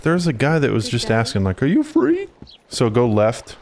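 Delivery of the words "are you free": high-pitched mimicked question; imitated game character. Quoting the man who was asking, high imitation.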